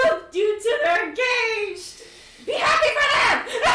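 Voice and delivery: high, loud, half-sung scolding shout; excited yell. Acted. Anna telling Damar to be happy, excited yell. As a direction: belt it with joy.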